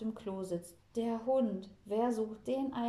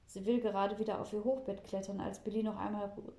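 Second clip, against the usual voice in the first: plain reading voice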